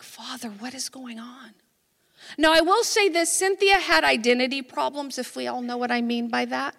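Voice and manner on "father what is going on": much softer, under the breath; retold quiet, bewildered prayer; hushed prayer. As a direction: murmur a private question to God.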